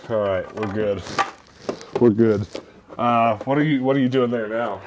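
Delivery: ordinary talk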